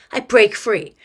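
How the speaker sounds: ordinary delivery, nothing marked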